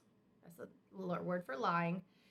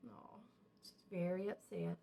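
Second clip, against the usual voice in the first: whisper; 4 semitones lower, 6 dB quieter than usual. Real, not acted. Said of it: "whisper heard".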